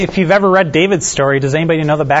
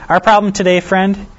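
a hint of a Southern radio preacher's accent, pitched higher on problem; half-tried Southern accent; 6 semitones higher than usual. Pastor briefly tries a Southern accent quoting a radio preacher, then gives up. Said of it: "I wish I could do the Southern accent".

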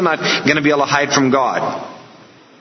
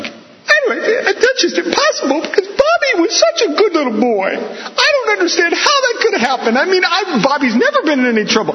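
very high-pitched mock mother; hammed-up distraught mom in a high voice; interviewed mom; 14 semitones higher than usual. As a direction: protest her boy's innocence in high falsetto. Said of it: "they invariably interview Mom".

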